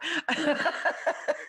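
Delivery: high breathy giggling burst; high giggle. Real, not acted. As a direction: giggle at own joke. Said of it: "I'm laughing at my own joke here".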